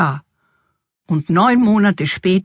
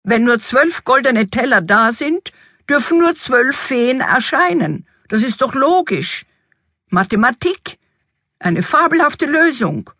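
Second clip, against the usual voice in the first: high, triumphant reasoning; exuberant king; 7 semitones higher than usual. A narrator's reading. King reasons away the thirteenth fairy, exuberant. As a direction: build to a high, gleeful finish. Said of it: "in seinem Überschwang".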